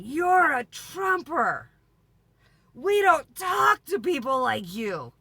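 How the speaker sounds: slow, high, loud hammed-up shout; mock furious old lady. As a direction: bark it like an outraged tiny grandma. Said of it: "All of a sudden".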